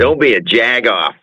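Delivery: trying out American slang; attempted American accent. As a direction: put on an amateur American accent. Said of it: "as good as my American accent".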